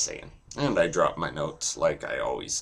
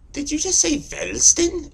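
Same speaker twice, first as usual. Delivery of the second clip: much higher, loud incredulous squeal; high squeaky voice; 6 dB louder than usual. Pretend. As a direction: squeak it out in disbelief. Mocking high outburst.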